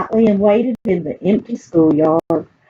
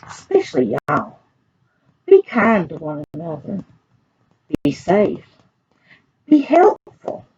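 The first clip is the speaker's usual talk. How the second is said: put-on reading to children; 2 semitones higher than usual. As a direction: read it like a picture book to a class of children. Librarian reads picture book to kids.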